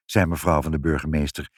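ordinary delivery, nothing marked

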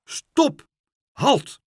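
high, loud alarmed shout; urgent warning cry. A narrator's reading. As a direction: yell a sudden halt, each word separate. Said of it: "maar meneer Pen schreeuwde".

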